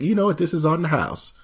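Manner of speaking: high, sweet Southern twang; Texas twang. Retold or imitated. Waitress giving him the shake free, high twang. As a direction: play a Texas waitress treating a customer. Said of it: "she gave, like".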